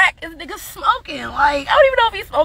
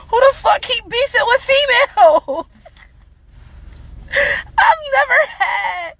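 incredulous outburst; 10 semitones higher than usual. Real, not acted. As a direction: shout it in disbelief, cracking up. Incredulous rant about beefing.

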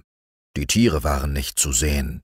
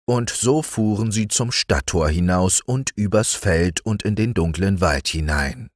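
usual speaking voice

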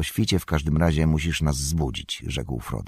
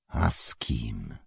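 low, slow and soft; quiet challenge; 5 semitones lower, 5 dB quieter, slower than usual. A narrator's reading. Strider answers the innkeeper's slur, quietly challenging. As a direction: drop the voice into a measured challenge.

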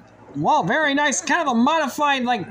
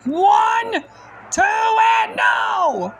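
excited shout; 9 semitones higher, much slower than usual. Acted. Near-fall count.

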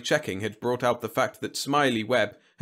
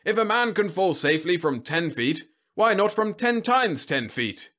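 high-pitched, forceful argument; booming; 9 semitones higher, 4 dB louder than usual. A narrator's reading. General arguing with Whitlow, booming. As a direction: press the case at full bluster. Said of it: "the general boomed".

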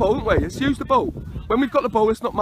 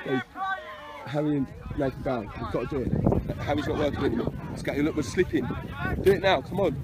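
low, very soft murmur; hushed touchline discussion; quiet aside; 13 semitones lower, 12 dB quieter, faster than usual. Real, not acted. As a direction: confer privately about a change. Substitution talk with assistant.

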